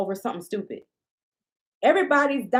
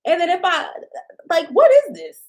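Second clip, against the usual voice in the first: incredulous outburst; 8 semitones higher, 7 dB louder than usual. Real, not acted.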